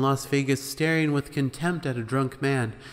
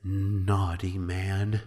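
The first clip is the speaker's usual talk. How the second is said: disapproving host; 5 semitones lower, much slower than usual. A narrator's reading. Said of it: "Ed shakes his head and says".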